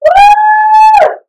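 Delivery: high whooping cheer; loud excited woo. Real, not acted. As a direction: whoop to pump up the class. Trainer cheering the class.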